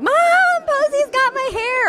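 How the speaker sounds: mock a boy telling on his baby sister; whiny kid tattling. Mother imitating her son complaining about his sister, high whine. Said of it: "They're like".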